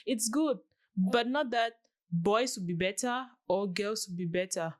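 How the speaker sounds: ordinary talk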